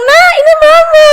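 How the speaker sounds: put-on very high, loud sing-out to a baby; hammed-up squeal of a new mum; cooing mother. Preacher mimicking mum introducing herself to her newborn, high loud cooing.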